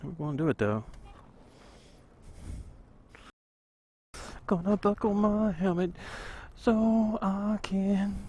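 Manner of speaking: muffled through a helmet; talking inside a closed helmet; inside a full face helmet. Real, not acted. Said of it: "full face helmet".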